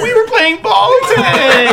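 put-on high, slow whiny kid. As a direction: play a little boy explaining. Acting out a kid's answer.

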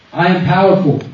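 loud affirmation for the crowd to repeat; booming motivational speech. Real, not acted. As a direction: proclaim it for the room. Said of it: "please repeat after me".

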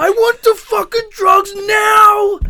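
yelling junkie craving drugs; loud harsh voice. Retold or imitated. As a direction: shout it like a desperate addict. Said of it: "You're like".

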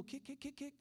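soft, high-pitched repeated cues; hushed coaching. Retold or imitated. Preacher as teacher bringing kids along, softly coaxing. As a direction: gently chant a child along. Instructor coaches child to kick.

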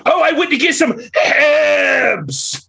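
high-pitched "Hebs" with no H, drawn out; mock dropped H. Debater mimics how his side talks, hammed-up dropped H. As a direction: slowly send up "Hebs", H silent and exaggerated. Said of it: "We drop the H ... They'll be like".

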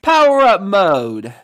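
loud, high battle command; power-up shout. Acted. Ranger powering up mid-fight, a loud high shout. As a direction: call it out like a hero powering up.

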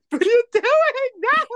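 hammed-up crying protest; mock anguished cry. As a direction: wail it like a kid watching the scene. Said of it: "I'm just like".

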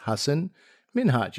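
Hassan Minhaj said deliberately and softly, HUH-sun MIN-hahj; careful name pronunciation. Retold or imitated. Guest teaching the host his name, Hassan Minhaj pronounced carefully. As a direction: model Hassan Minhaj quietly, each name set apart. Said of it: "this is how you pronounce my name".

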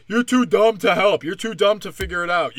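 loud, punchy mocking retort; mock condescension. FBI talking down to the public, loud mock condescension. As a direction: imitate officials talking down loudly.

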